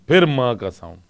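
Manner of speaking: ordinary delivery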